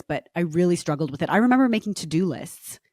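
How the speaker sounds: ordinary delivery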